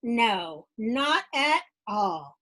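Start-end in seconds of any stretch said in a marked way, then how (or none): none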